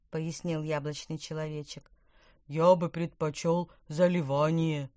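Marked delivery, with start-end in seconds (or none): 2.5-4.9 a bubbly fish voice burbling from the water; gurgling carp. A narrator's reading. The carp gurgles a reply from under the jetty, mock bubbly. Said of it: "пробулькал карп".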